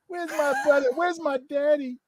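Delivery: ordinary talk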